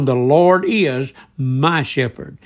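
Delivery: slow, deliberate weight on "is" and "my"; emphatic statement. Retold or imitated. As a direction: stretch out "is", then land firmly on "my shepherd". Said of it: "made a very emphatic statement".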